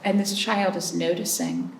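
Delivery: usual speaking voice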